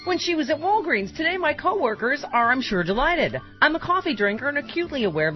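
bright upbeat ad read. Acted. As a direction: read it like a cheerful ad. Reads testimonial ad in style.

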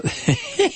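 sudden high laugh breaking out; burst of high laughter. Real, not acted. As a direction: crack up mid-sentence. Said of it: "can't say his name without laughing".

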